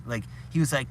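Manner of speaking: normal talking voice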